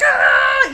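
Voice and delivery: high, loud cry of frustration; frustrated shout. Retold or imitated. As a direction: let out a fed-up yell. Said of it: "and I'm like".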